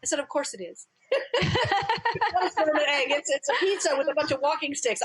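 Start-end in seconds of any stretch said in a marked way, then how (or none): 0.2-0.9 laughing through the words, softer; amused reply, laugh breaking in; mother answering her daughter with a soft laugh. Retold or imitated. Mother humoring child, laughing. 1.1-2.1 guest laughing at her daughter's pizza story; burst of laughter. Real, not acted. Laughing at the memory. 2.1-5.0 talking through laughter, higher; words pushed out between laughs; laughing. Real, not acted. Still laughing at memory.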